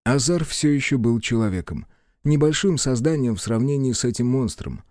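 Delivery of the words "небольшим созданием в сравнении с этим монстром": usual speaking voice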